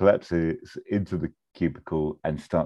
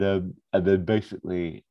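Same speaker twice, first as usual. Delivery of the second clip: ordinary talk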